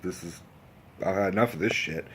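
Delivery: low, soft acted grumble; quietly fed up. Cook thinking he's had enough, quietly fed up. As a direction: keep it under the voice, like a thought. Cook's unspoken fed-up thought, first take.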